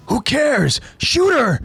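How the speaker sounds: high-pitched yelled order; heated, urgent soldier's command; agitated soldier. A narrator's reading. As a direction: yell at your partner to act. Soldier orders partner to shoot.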